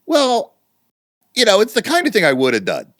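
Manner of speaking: hammed-up evasive suspect; coy evasive answer. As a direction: wriggle out of the question, playing coy. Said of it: "Being coy".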